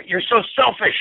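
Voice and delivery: loud angry blame; loud accusation. Shown as a demonstration. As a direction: snap it out with force.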